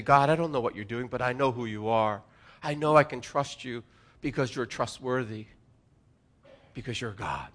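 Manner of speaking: soft, hushed prayer voice; quiet prayer. Shown as a demonstration. Pastor modelling a prayer, soft and sincere. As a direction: pray it gently, inwardly.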